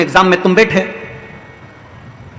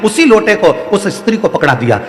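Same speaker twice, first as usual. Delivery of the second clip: usual speaking voice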